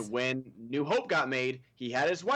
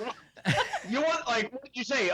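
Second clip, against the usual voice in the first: higher, confused mock voice with laughter; mock confused Jedi; 10 semitones higher than usual. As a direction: play a baffled Jedi, laughing. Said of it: "it's like".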